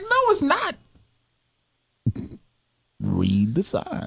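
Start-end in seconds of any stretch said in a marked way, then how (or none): 0.0-0.7 high indignant retort. Retold or imitated. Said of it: "I was like".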